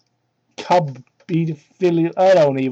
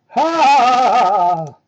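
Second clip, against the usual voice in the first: mock shout; 5 semitones higher, 7 dB louder than usual. Fusion dance shout.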